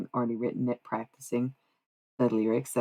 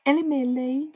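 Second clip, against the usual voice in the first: much higher put-on voice for the rap name; squeaky hype name call; funny voice; 11 semitones higher than usual. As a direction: announce it like a hype man.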